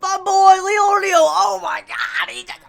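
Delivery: yelling high and loud with joy; excited screaming. Real, not acted. Fan reacting to Leorio's big moment, ecstatic yelling. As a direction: scream it out like your hero just won.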